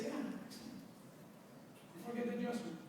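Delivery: soft, half-whispered deadpan; muted sarcastic reply; dry aside. Retold or imitated. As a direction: throw it away under your breath. Retelling his own dry reply.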